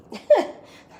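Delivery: bright high burst of laughter. Real, not acted. Laughs at tight jacket.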